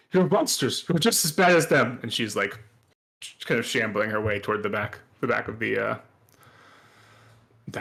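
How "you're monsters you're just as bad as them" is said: higher, louder accusation; angry rant. Acted. Shopkeeper ranting at the intruders, higher and louder. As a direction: spit the accusation at them. NPC rants at them.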